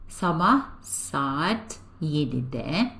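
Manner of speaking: slow model pronunciation. Shown as a demonstration. Teacher gives answer slowly.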